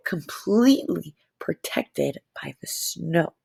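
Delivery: slow secret whisper; soft slow whisper. Acted. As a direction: hush it like the bear is hiding. Whispers about hidden polar bear.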